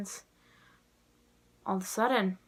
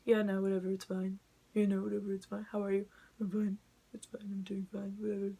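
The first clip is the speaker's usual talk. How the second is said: mild mock brush-off, trailing softer; mock dismissive tone; 5 dB quieter than usual. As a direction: lightly imitate someone shrugging off feelings. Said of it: "That's my mock tone".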